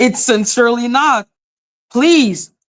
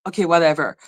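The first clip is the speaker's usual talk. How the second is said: dismissive; 6 semitones lower, 3 dB quieter, much faster than usual. Retold or imitated. Acting out own indifferent reaction.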